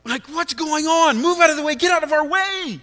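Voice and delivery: loud, high angry yelling at the driver ahead; retold road rage. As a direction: shout him out of the way, fuming. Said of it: "and I'm like".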